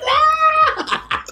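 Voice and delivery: sudden high shriek; shocked shriek. Retold or imitated. Hearing [name]'s glitter read, a high scandalized shriek. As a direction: squeal in scandalized delight. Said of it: "And I was like".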